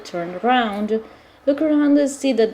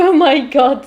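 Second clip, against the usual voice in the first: loud, high laughing exclamation; 4 semitones higher, 6 dB louder than usual. Real, not acted. Player reacting to the retired bodyguard, laughing loudly. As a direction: burst out laughing in disbelief.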